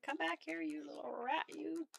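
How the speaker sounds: high-pitched mock scolding; playful scolding. Hunter chasing a little rat, playful scolding. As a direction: call after it in a high, teasing voice. Calling after a fleeing rat.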